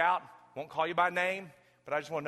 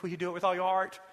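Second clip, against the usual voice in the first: louder and higher coach's challenge; coach's rallying call; 5 semitones higher, 6 dB louder than usual. Retold or imitated. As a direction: call it out like a coach to his boys.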